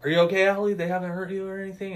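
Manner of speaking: high, fast worried voice, lightly acted; a hint of a fretful hillbilly; concerned Dale. Retelling the film, Dale checks on Allie with mock concern. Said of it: "Dale's like".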